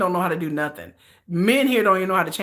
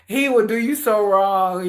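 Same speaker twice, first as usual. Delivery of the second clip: usual speaking voice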